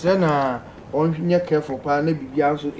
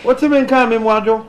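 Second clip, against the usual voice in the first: louder and higher, calling down a bad phone line; 6 semitones higher, 6 dB louder than usual. Real, not acted. Host calling out to a caller on a bad line, loud. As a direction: raise the voice so the caller can hear.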